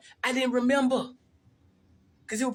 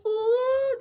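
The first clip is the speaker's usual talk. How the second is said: very high, louder sustained hoot; imitated squeal held on one high note; mock giddy "ooh"; 5 dB louder than usual. Mimicking cult members posing for a picture, high mock "ooh".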